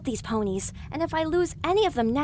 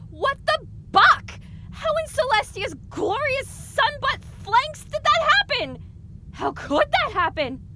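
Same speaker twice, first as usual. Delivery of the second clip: shocked outburst; 13 semitones higher than usual. Acted. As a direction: blurt it out in total shock. Shocked at the news.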